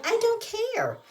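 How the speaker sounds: very high squealed retort; hammed-up high-pitched protest; acted defiance. Acted refusal in retold quarrel.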